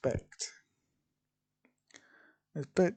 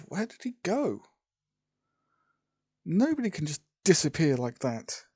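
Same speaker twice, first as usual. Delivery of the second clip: higher, louder astonished outburst; stunned disbelief; 4 semitones higher, 4 dB louder than usual. A narrator's reading. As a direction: blurt it out in acted bewilderment. Stunned character after a vanishing.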